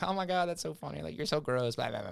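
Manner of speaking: teasing girlfriend, hammed up high; mocking the roommate's girlfriend. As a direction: play her as a squealing, laughing girlfriend. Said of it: "was, like, laughing".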